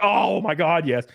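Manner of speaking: high, drawn-out grateful outburst; exaggerated, high-pitched relief; retold desperate relief. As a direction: act out being rescued at last. Acting out desperate relief at rescue.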